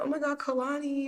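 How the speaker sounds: high-pitched imitation of a gushing customer; excited greeting.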